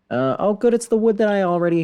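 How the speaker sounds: high, loud burst of relief; excited. Real, not acted. Streamer glad the recipe uses wood already owned, excited. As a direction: bounce up with glee.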